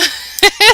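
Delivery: high, bright burst of laughter; amused laugh. Real, not acted. Builder laughing at shrinking the cactus, high burst of laughter. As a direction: laugh at your own silly build.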